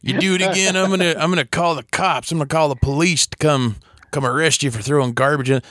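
hammed-up threatening caller; mock angry custodian. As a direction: imitate a janitor's threat, starting high.

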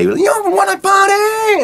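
very high, drawn-out rock frontman shout; hammed-up metal singer's stage yell. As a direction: scream it to a huge arena crowd. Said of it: "to be more like [name]".

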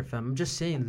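ordinary delivery

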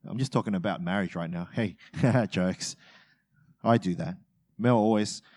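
ordinary talk

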